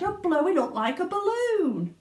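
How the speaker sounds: very high frantic cry; shocked screech; alarmed father. Acted. Father shrieks as Violet balloons. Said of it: "screeched Mister Beauregard".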